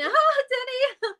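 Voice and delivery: high, slow, giggly "Oh, Denny"; laughing dismissal. Retold or imitated. Girls laughing off the producer's rudeness, high and giggly. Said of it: "You laugh and".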